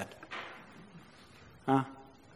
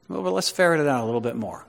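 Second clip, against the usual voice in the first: ordinary talk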